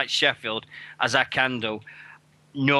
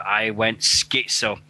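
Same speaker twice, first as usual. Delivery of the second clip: ordinary delivery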